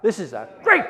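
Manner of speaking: pumped up, "great" hit loud and high; pumped-up forced whisper. Shown as a demonstration. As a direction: drive "great" hard to fire up the room. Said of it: "oh, come on, pump it up".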